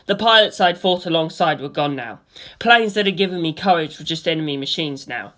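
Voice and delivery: usual speaking voice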